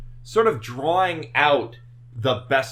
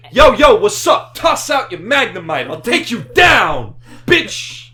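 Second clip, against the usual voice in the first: put-on loud, high tough-kid trash talk; high school gangsta kid voice; 7 semitones higher, 10 dB louder than usual. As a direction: shout it like a wannabe gangsta kid. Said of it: "When they should be like".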